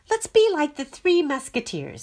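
loud, high rallying cry; rally the friends together; eager suggestion. A narrator's reading. Clacy rallying the voices to unite, eager and loud. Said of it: "said Clacy".

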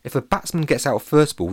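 plain reading voice

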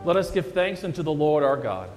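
ordinary talk, nothing marked

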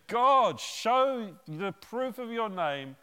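loud, high and slow, calling to heaven; dramatic call to God. Retold or imitated. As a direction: proclaim it like a prophet on the mountain. Elijah calls on God.

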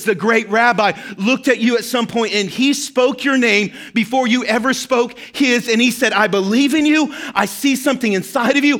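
loud, high, building sermon climax; preaching at full force. Acted. Pastor urging the congregation at full force, loud and high. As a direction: drive the message home loudly.

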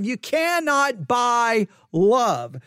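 slow, with cannot hammered; emphatic. Acted. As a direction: preach it, pounding cannot. Preacher driving the point home.

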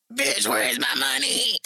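a lightly voiced tough demand; mock growl for her money; put-on demanding voice. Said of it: "In that voice".

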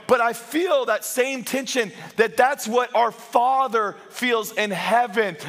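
slow, emphatic pulpit build; impassioned preaching. Real, not acted. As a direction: preach it phrase by phrase, drawn out. Preaches at full force.

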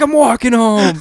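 high-pitched mock indignant call; retold shout back. Embarrassed drunk calling back to his buddy, mock indignant shout. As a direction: call back over your shoulder, high and put out.

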